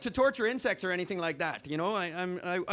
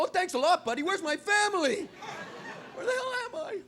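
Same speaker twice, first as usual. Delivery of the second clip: voicing a lost fly; 9 semitones higher than usual. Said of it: "Poor fly's gotta be going".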